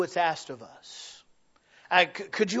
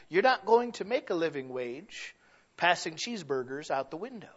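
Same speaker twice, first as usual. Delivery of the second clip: ordinary delivery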